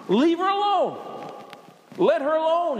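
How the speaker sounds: acted firm order to back off; firm rebuke. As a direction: stand up for her, voice lifted. Jesus's rebuke of Judas acted.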